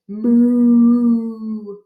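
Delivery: long, loud lowing moo; cow moo. Acted. Reader voicing a cow's moo.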